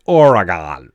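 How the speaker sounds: Oregon said or-eh-gahn, full gone sound at the end; mock his old way of saying Oregon; wrong Oregon. Shown as a demonstration. Showing listeners his old mistake, Oregon as or-eh-gahn. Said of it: "I was just saying Oregon wrong".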